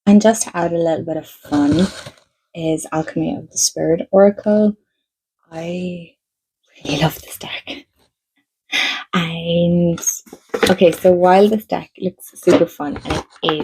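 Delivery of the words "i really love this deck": soft breathy whisper, no voice; whispered confession. Real, not acted. Telling viewers she loves this deck, whispered.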